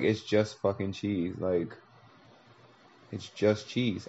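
quiet, slow letdown; softly disappointed shrug; deflated. Retold or imitated. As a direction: let the thrill drain out of it. Disillusioned mouse tastes cheese.

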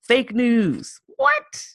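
high, loud disbelief; incredulous. Retold or imitated. Mocking Trump supporters' excuses with high incredulous yelps. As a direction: throw it at them in mock disbelief. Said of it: "I'm like".

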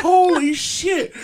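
high, loud, drawn-out disbelief; stunned exclamation. Retold or imitated. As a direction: gasp it out, floored. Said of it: "I was like".

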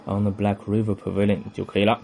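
ordinary talk, nothing marked

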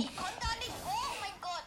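frustrated. Real, not acted. Frustrated at being stuck.

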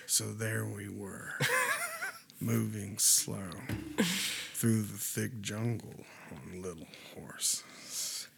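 low, slow, soft Harrison Ford storyteller voice; Harrison Ford narration. Retold or imitated. Friend retells jungle horse story as Harrison Ford, slow low narration. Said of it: "what if we could hear this whole story from, like, Harrison Ford".

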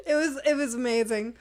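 worn out from laughing so hard; tearful laughter. Real, not acted. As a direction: gasp it out while crying with laughter. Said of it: "trying not to cry because I'm laughing".

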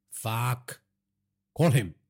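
mildly angry curse, then a firm order; curse, pause, then push the order out. A narrator's reading. Father orders son to call the driver, angry. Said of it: "Naresh said angrily".

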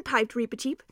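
ordinary delivery

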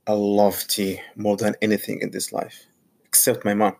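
ordinary talk, nothing marked

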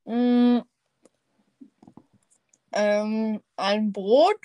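talking with a mouth full. Real, not acted. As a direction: speak around a mouthful of bread. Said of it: "Mit vollem Mund spricht man nicht".

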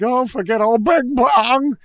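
loud, high, slow drawn-out cry; mock wail. Forgotten character crying out, loud high slow wail. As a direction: wail it like a fading cartoon. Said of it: "I'm like".

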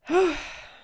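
sudden high burst of laughter; a real laugh after swearing. Real, not acted.

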